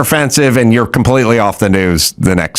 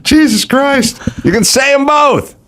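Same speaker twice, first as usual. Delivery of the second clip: much higher, fed up; exasperated high rant. Retold or imitated. Fed-up customer ranting at the barber, exasperated. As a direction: scold him from the barber chair.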